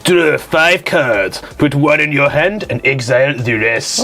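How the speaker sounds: deliberate mock French accent. Reading forfeit card to the table, put-on French accent. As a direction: read the card out as a comic Frenchman. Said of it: "it's still gotta do the French accent".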